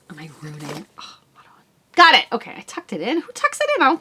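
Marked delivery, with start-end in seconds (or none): 0.1-1.6 soft muttered aside; muttered to self. Real, not acted. Fiddling with the yarn, muttering softly. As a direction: talk under your breath.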